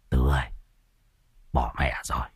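Lão Tam whispering to Tứ about a dangerous enemy, hushed and low. A narrator's reading. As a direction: whisper it so nobody else hears. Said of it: "Lão Tam thì thầm".

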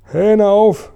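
high, drawn-out yell; storyteller's high shout; shrieked command. A narrator's reading. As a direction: call it out high and long, like a far-off order. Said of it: "schrie sie".

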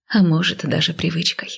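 usual speaking voice

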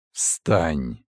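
low, hollow command inside the head; low voice commanding from within the mind; whispery telepathic voice. Acted. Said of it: "Голос раздался в голове".

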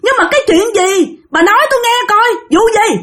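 high, exasperated demand; indignant protest. Retold or imitated. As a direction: demand an explanation. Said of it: "bạn rất là tức".

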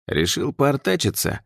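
smirking taunt, pitched high; mocking. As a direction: sneer it with a grin. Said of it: "В голосе гвардейца была усмешка".

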